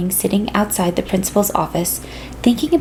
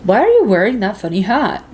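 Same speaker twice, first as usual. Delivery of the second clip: little brother voice. A narrator's reading. Will questions the Martian.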